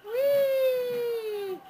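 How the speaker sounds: high delighted cheer; cheering whee. Real, not acted. Cheering baby spinning.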